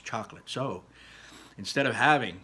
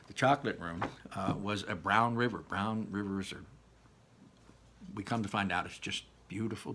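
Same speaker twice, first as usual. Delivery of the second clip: plain reading voice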